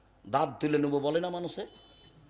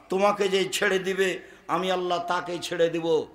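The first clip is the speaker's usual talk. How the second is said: normal talking voice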